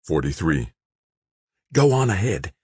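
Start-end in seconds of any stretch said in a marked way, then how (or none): none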